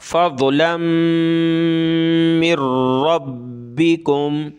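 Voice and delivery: रब्बिकुम with a firm r and doubled b, slowly; tajweed shadda; hard ra and ba. Shown as a demonstration. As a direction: press हard on the r and b of रब्बिकुम. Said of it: "रा को और बा को दोनों को सख्त करेंगे".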